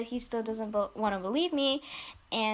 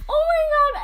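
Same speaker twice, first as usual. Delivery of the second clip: high, loud laughing reaction; laughing retelling; 13 semitones higher, 6 dB louder than usual. Retold or imitated. Retelling own reply to the stalker text, laughing. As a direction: squeal it through laughter.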